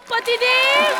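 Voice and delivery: high call for her husband. Acted. Bride looking for her husband, calling out. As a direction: call out to someone out of sight, pitched high.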